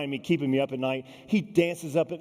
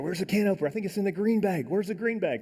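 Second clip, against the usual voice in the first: higher-pitched fretting over a lost thing; fretful searching; 6 semitones higher than usual. Retold or imitated. Climber hunting for dinner gear at midnight, fretful. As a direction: act out a flustered hunt.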